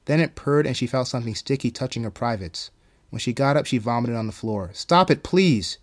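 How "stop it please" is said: slow, loud, high-pitched plea; pleading. A narrator's reading. Marigold begging the voice to stop, pleading. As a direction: beg for it to stop.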